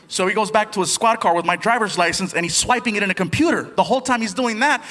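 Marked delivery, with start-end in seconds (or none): none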